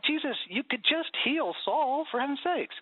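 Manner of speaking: high, exasperated protest; a mock groan of exasperation at Jesus; exasperated plea. Pleading with Jesus to just heal Saul, mock exasperated. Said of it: "it's this thing of like".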